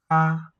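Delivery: "Ka" held high, level and loud; first tone. Shown as a demonstration. Teacher isolating a syllable, "Ka" high and level. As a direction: sing out "Ka" on one high level pitch. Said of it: "they both have the first tone".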